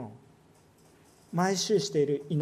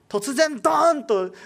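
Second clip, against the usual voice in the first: dramatic boom; 8 semitones higher, 8 dB louder, slower than usual. Acted. Preacher dramatizes sudden change.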